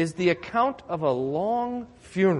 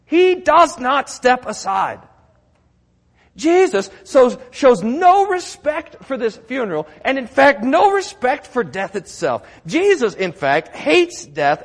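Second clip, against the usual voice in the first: louder and higher, driving each phrase home; full force preaching; 6 semitones higher, 6 dB louder than usual. Acted. Pastor hammers home Jesus' victory, fervent and loud. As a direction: preach it hard to the congregation.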